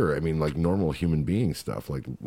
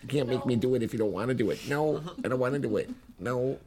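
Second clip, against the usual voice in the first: higher, coy imitation of a shy girl; mocking coy voice; 6 semitones higher, 3 dB quieter than usual. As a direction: tease her with a high coy whine. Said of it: "that's all she says".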